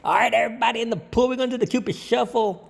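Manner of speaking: high, fast party MC announcement; hype DJ call. Retold or imitated. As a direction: fire up the pool crowd, fast and high.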